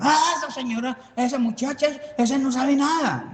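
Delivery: high-pitched mock scoffing; mocking online critics. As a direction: imitate viewers dismissing her in a high voice. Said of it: "para que los diutinos digan".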